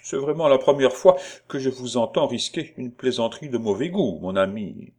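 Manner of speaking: offended. Acted. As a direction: scold him with wounded dignity. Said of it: "dit d'un ton offensé".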